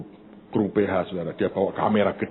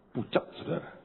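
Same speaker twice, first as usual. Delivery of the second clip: whisper; 3 semitones higher, 9 dB quieter than usual. A narrator's reading. Preacher hushing to the congregation, whispered. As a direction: whisper "Pucat, saudara" under the breath. Preacher describes shocked man.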